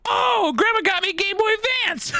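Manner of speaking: very high, squealing excitement; high-pitched, hammed-up freak-out. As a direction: squeal it like an overjoyed kid. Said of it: "like, freaking out".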